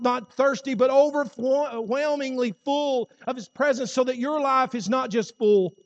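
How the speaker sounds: rally the church; preaching at full force. Acted. Preacher urging the congregation daily, loud and high.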